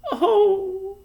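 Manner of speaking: soft moan. A narrator's reading. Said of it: "moaned Homily softly".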